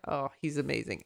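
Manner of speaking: ordinary talk, nothing marked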